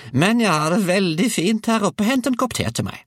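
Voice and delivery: put-on very high old-woman voice; bossy grandmother. A narrator's reading. Grandmother demands tea from the roof, high call. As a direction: order him about in a high screech. Grandmother ordering boy about.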